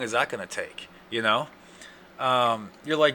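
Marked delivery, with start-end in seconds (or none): none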